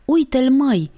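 put-on high excited burst from a boy; sudden, high-pitched exclamation; little boy's outburst. A narrator's reading. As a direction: burst out as a small boy spotting it. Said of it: "izbucni un băiețel".

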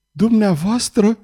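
high, slow, startled question; frightened stammer. A narrator's reading. Terrified singer recognising the duke before her, frightened stammer. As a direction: ask it slowly in mild fright. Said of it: "bâigui ea înspăimântată".

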